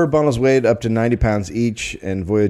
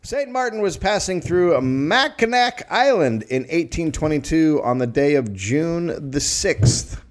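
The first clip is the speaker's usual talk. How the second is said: dramatic narration; 8 semitones higher than usual. Acted.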